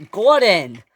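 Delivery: loud cry of blame; screamed name. Acted. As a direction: yell his name in outrage. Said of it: "screamed James".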